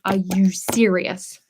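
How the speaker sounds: slow, drawn-out disbelief; slow fed-up are you serious; exasperated. Retold or imitated. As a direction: drag it out, fed up. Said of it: "And I was like".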